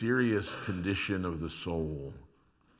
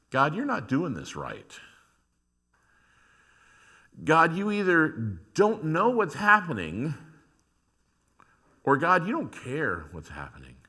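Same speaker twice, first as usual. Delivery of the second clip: accusing God; 5 semitones higher, 4 dB louder than usual. Acted. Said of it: "this takes it to".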